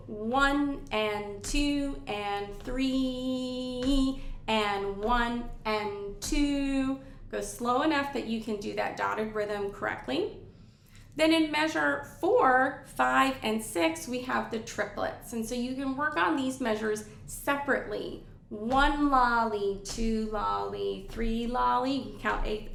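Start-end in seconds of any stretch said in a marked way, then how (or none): 0.1-7.0 slow, drawn-out count of even eighths; counting aloud. Shown as a demonstration. Oboe teacher counting out even eighth notes, slow and drawn out. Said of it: "You can start off by counting". 18.5-21.9 slow, even triplet syllables; counting triplets. Shown as a demonstration. Teacher showing students how to count triplets, slow and evenly spaced. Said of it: "triplets like that".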